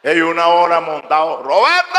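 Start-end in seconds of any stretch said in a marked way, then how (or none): 1.4-2.0 llamada aguda y alargada a Roberto; grítale desde la calle; llamando a gritos. Acted. Llamando a Roberto otra vez. (llamando a Roberto a lo lejos)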